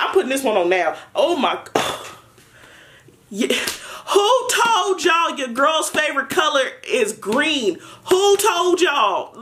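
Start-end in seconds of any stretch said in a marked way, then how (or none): 4.1-9.3 high, slow, delighted yelling; excited shout. Real, not acted. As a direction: shout it out in thrilled disbelief. Excited reaction to green case.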